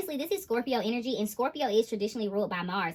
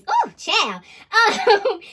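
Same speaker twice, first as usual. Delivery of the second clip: laughing; 9 semitones higher, 11 dB louder, much slower than usual. Real, not acted. Reader laughing at her casino joke, loud and high.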